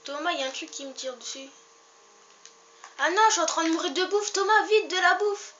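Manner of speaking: high, fast, loud panicked pleas; panicked shouting. Real, not acted. Starving in game, begs for food.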